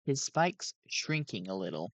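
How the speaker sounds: usual speaking voice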